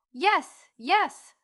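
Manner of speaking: high, loud, delighted yes; overjoyed little child's cry. A narrator's reading. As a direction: burst out, thrilled to be understood. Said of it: "cried Phronsie, perfectly delighted".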